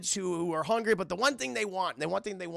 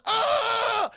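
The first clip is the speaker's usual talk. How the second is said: long, high, loud "aaah" scream; mock scream of pain; 7 dB louder than usual. Streamer acting out a character's bone popping, drawn-out pain scream. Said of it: "He goes".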